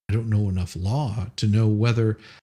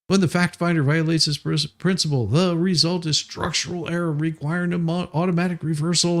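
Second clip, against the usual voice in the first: reading legal text aloud in a raised, pompous tone; mock formal brief reading; 6 semitones higher than usual. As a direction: declaim it like a self-important lawyer.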